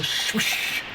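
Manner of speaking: usual speaking voice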